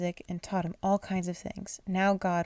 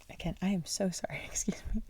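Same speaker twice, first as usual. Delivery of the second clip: hushed, breathy aside under the reading; whispered apology; 7 dB quieter than usual. Real, not acted. Reader whispers an apology to listeners, hushed. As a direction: drop to a whisper to say sorry.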